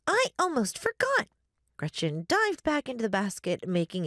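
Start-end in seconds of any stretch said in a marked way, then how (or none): 0.1-1.3 put-on high-pitched little-girl exclamation; excited child ghost. A narrator's reading. Child ghost remembering a dish for her guests, excited. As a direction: pipe up high, suddenly remembering.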